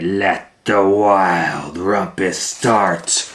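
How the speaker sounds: loud, slow call; proclaiming. Acted. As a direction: announce the rumpus grandly. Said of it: "Max cried".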